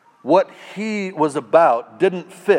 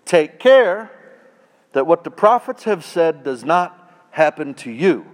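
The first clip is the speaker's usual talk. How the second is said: louder, higher scripture warning; warning read; 3 semitones higher, 6 dB louder than usual. Acted. Preacher reading Paul's warning to the crowd, stern.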